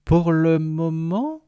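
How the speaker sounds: slow, drawn-out puzzled question. Acted. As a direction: stretch it out in surprise.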